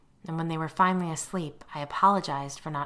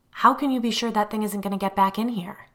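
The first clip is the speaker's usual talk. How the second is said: alarmed; 6 semitones higher, 7 dB louder than usual. A narrator's reading. Terrified husband demands.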